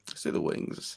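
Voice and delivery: whispered agreement; hushed echo. Real, not acted. Echoing the co-host's "got to do the wings", whispered. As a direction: drop to a whisper.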